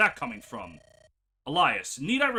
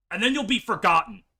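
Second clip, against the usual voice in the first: usual speaking voice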